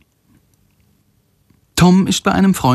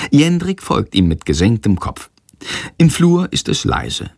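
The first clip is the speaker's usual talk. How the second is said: ordinary delivery, nothing marked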